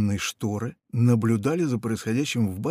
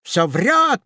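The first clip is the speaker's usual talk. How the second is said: higher, louder grumble; grumbling parrot; 9 semitones higher, 5 dB louder than usual. A narrator's reading. Parrot grumbles that the pirate lies. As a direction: grumble it out of the side. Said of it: "проворчал попугай".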